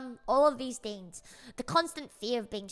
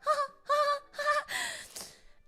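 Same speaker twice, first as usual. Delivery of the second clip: laughing. Real, not acted. Laugh after taunt.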